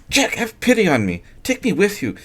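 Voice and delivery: the wife begs her husband to take her along; pleading young wife. A narrator's reading. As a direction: plead with him. Sylvia's voice held.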